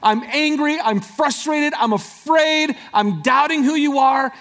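full-force preaching lament; anguished cry out. Acted. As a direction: cry it out like a desperate prayer. Preacher voicing a despairing soul.

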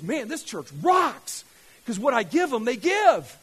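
loud, emphatic delight, building in force; fired up. Acted. God looking at the giving church, fired up. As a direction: preach it as God's thrilled approval. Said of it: "sorry for getting fired up".

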